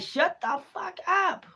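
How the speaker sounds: high, slow, menacing growl of the deity; hostile spirit voice. Retold or imitated. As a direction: voice the angry spirit stretching each word. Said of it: "and then me stumping".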